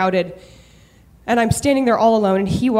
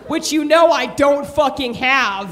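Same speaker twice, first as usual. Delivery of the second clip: put-on Boston accent; 4 semitones higher than usual. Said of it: "I slip into a Boston accent".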